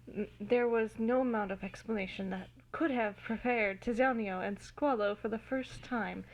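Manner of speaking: ordinary delivery, nothing marked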